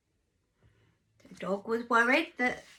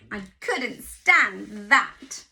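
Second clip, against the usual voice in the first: slow, louder miserable sheep; sulky sheep; 4 semitones lower, 7 dB louder, much slower than usual. Acted. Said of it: "said Sheep".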